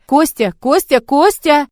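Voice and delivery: mother calling her missing son; calling out in panic. Acted. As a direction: shout his name, rising each time. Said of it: "продолжая звать его".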